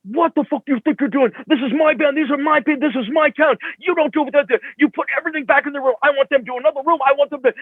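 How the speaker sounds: high, heated yelling at the road manager; angry tirade. Retold or imitated. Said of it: "he just, like, was hounding him".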